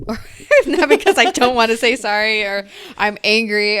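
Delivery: loud, amused second attempt; laughing while correcting yourself; laughing restart. Real, not acted. Laughs after flubbing her sentence.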